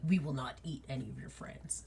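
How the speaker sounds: hushed, quick solemn promise; quiet fast wolf pledge. Acted. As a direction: murmur the vow quickly and softly. Wolves pledging, voiced by GM.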